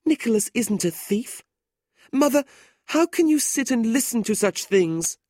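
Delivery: high, pleading sister's voice; distressed young woman. A narrator's reading. Kate pleads with her mother, high and upset.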